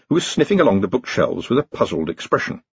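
ordinary delivery, nothing marked

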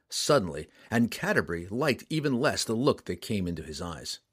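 ordinary delivery, nothing marked

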